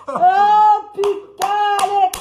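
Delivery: high, drawn-out outburst; loud exclamation. Real, not acted. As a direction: cry out loudly. Exclaims as the clash starts.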